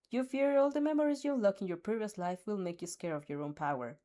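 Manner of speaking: ordinary delivery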